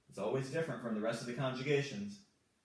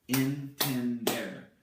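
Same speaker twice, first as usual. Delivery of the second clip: "Entender" slowly and loudly, in three separate syllables; 6 dB louder, much slower than usual. Shown as a demonstration. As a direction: break "Entender" into three firm, drawn-out syllables. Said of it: "There are three syllables in entender".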